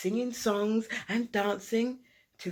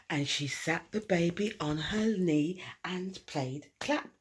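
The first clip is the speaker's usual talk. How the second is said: usual speaking voice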